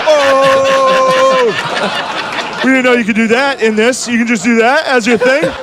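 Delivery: high, loud amazed crowd. Retold or imitated. Retelling the crowd's shock at the debate, high and loud. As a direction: gasp it like a shocked audience. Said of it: "the whole crowd was like".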